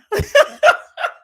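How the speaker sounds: high loud burst of laughter; real loud laugh. Real, not acted. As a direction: cackle at the outfit. Laughing at bow tie.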